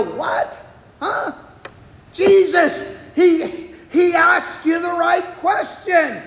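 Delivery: a startled listener's mock outburst; startled what. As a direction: blurt it out, half laughing in disbelief. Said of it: "It should make you and I go".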